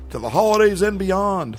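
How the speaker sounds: booming, heroic cartoon astronaut; jokey heroic catchphrase voice, swooping high; toy space hero catchphrase. Jokey Buzz Lightyear style sign-off.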